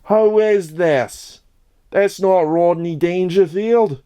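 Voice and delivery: quavering mock old-timer; confused old person. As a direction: play a baffled nursing-home viewer. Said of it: "geriatrics sitting there like".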